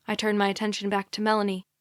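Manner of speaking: ordinary delivery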